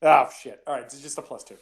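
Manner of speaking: ordinary talk, nothing marked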